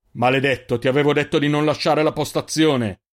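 loud furious rebuke; angry scolding. Acted. Jofix strikes and scolds Ander, loud anger.

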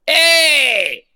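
long, high Italian-style "hey"; hammed-up Italian shout; Italian accent. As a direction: holler it like a cartoon Italian. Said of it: "we're back in Italy".

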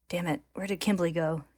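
ordinary delivery

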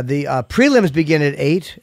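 normal talking voice

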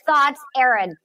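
usual speaking voice

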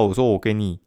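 ordinary talk

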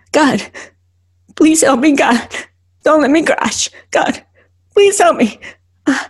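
high, frightened praying; panicked pleading. Acted. Driver begs God not to let her crash, panicked pleading. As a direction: beg for help as panic mounts. Panic attack poem acted out.

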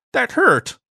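high, pained little whine; hurt alien whine. Acted. Ziggy wheezes in pain after the blast, high whine. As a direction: whimper it out.

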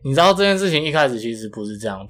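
normal talking voice

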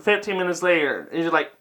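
normal talking voice

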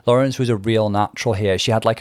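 usual speaking voice

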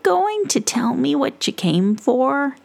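high, sing-song witch. Acted. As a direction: play the curious witch. Witch voiced in story.

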